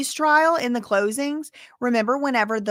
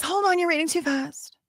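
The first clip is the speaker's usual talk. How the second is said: interrupting judge; 6 semitones higher than usual. Retold or imitated. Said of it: "So the judge says".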